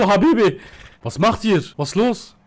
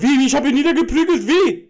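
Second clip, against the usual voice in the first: fast, high-pitched disbelief; rising, agitated indignant question; incredulous protest; 7 semitones higher, 3 dB louder than usual. Pretend. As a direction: throw the accusation back, outraged. Indignant denial of the accusation.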